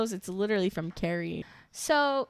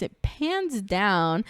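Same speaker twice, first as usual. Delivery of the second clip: drawn out slowly; much slower than usual. Acted. Describing camera pan slowly.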